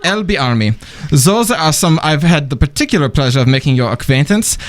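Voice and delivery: formal email read in a German accent; mannered, courtly German-accented reading. Shown as a demonstration. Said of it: "in my German accent".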